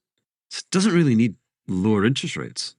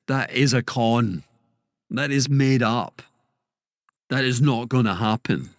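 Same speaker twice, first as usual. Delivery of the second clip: slow, every word hammered home; emphatic; 4 semitones higher than usual. Real, not acted. Pundit dismissing a rate-hike forecast, slow and emphatic. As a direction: spell it out slowly, like a flat verdict. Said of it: "I don't know why I speak like that when I get emphatic".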